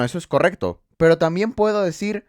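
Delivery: ordinary talk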